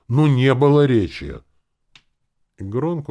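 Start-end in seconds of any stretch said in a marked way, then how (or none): 0.1-1.4 loud, high protest; raised voice. A narrator's reading. Elf objecting to orc in clan, raised voice. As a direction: lose patience and raise your voice. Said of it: "он не выдержал и повысил голос".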